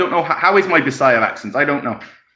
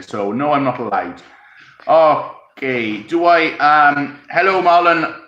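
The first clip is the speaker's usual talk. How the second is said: usual speaking voice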